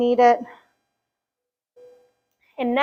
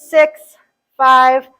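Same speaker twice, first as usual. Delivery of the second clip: ordinary talk, nothing marked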